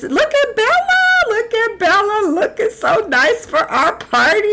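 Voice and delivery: high falsetto gushing over a guest; squealing high welcome; high gushing greeting. Pretend. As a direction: coo at her like a delighted hostess.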